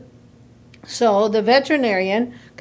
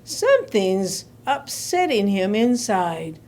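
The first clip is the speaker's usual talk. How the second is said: low, slow musing doctor; pondering vet; 5 semitones lower, slower than usual. Acted. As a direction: think it through slowly and low. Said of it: "and he said".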